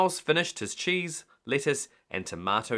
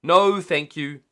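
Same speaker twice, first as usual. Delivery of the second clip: firm refusal; 6 semitones higher, 9 dB louder, slower than usual. A narrator's reading. Cheerful refusal outside.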